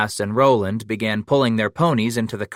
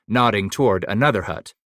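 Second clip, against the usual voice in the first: usual speaking voice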